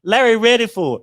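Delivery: loud, high, gleeful calling out; excited teasing shout; playful hype. Real, not acted. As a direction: hype up the chat member. Teasing Larry with a laugh.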